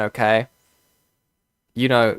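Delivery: usual speaking voice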